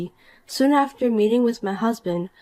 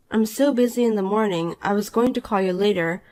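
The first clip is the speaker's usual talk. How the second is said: ordinary talk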